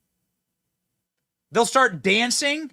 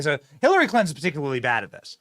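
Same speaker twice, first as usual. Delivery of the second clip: normal talking voice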